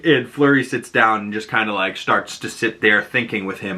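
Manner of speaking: ordinary talk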